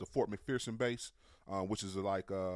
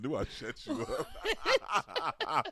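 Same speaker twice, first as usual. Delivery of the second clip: laughing; 7 semitones higher, much slower than usual. Real, not acted. Laughing at co-host.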